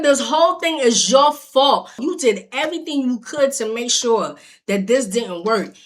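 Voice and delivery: upset rant at the ex; angry outburst. Retold or imitated. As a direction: throw the blame at him. Yelling at ex while leaving.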